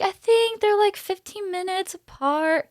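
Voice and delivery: very high, sing-out retold voice; high-pitched downplaying. Underestimating contraction times to her doula, high-pitched downplaying. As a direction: pitch it way up, drawing out the end. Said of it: "And I was like".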